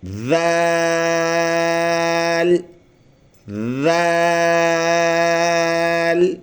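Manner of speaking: "ذال" with soft voiced th and very long aa; tongue on teeth, hold "ذال" on one note; soft, long letter. Shown as a demonstration. Teacher showing smooth soft reading, drawn-out. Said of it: "ایسے".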